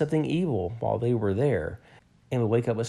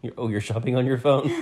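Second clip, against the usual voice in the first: ordinary delivery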